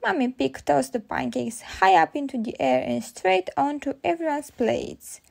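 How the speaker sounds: ordinary delivery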